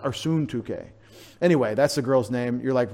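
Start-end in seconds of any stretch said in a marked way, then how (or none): 0.1-0.8 Syntyche as SOON-too-kay; Greek pronunciation. Shown as a demonstration. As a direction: say Syntyche with a long oo first. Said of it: "It'd be A".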